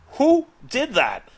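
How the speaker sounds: high, drawn-out astonishment; freaking out. Retold or imitated. Seeing the rendered image for the first time, astonished. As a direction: stretch it out slowly in amazed disbelief. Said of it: "both Hatter and I freaking out".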